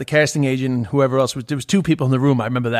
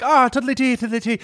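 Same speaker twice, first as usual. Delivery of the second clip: high-pitched stage-Irish lilt; sing-song caricature of an Irish accent, pitched up; mocking Irish accent; 8 semitones higher than usual. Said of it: "making fun of Conor McGregor".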